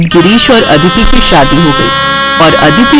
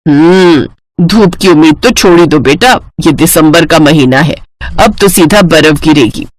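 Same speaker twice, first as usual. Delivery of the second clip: lower, matter-of-fact elder's voice; gently dash the bride's hopes; knowing mother-in-law; 5 semitones lower than usual. Acted. Mother-in-law tells bride snow is coming, knowing.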